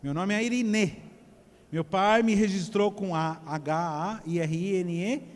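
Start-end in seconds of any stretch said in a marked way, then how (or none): 0.0-1.0 IRENE said as "ai-ri-NÊ"; circumflex final E. Shown as a demonstration. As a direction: end IRENE on a stressed, closed Ê. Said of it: "circunflexo no E".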